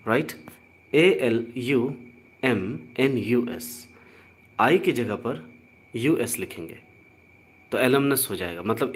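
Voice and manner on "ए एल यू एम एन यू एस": letters spelled out slowly one by one; slow spelling. Shown as a demonstration. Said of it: "spelling the word out". (अक्षर-अक्षर धीरे-धीरे बोलें)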